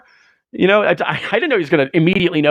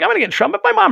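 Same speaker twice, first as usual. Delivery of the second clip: put-on high-pitched, lightly panicked kid; mock scared; 13 semitones higher, much faster than usual. Young camper dreads his mom finding out, mock scared. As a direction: voice a worried kid in a higher pitch. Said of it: "getting scared".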